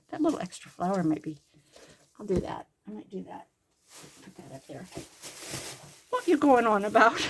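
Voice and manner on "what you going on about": louder mock-English question, swooping up; fake English accent. Grandma repeating her joke for the grandkids, fake English accent. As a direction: play dumb in a put-on English accent. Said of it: "your fake English accent".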